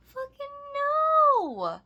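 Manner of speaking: very high, loud, drawn-out yell; screamed refusal. Retold or imitated. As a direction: stretch the refusal into a piercing shout. Emphatic retold refusal.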